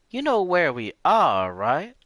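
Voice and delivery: put-on old lady voice. Lena voice again.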